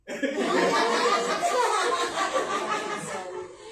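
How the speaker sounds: loud high laugh bursting out; loud high-pitched laughter. Real, not acted. Pastor laughs at the story.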